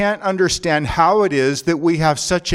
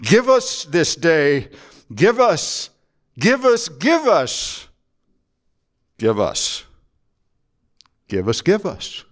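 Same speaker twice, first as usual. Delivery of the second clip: repetitive begging prayer; slower than usual. Shown as a demonstration. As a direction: beg again and again, mock pleading. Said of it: "we often repeat is when it comes to asking".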